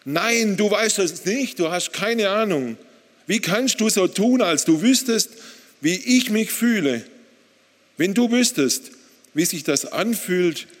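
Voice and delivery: loud and furious. Retold or imitated. Husband answering God's claim to understand, loud and furious. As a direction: hurl it back at God, loud and high. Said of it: "Ich antwortete laut und wütend".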